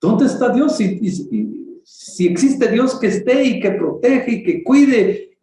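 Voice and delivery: high, slow despairing cry to God; anguished questioning. Retold or imitated. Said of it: "uno se pregunta".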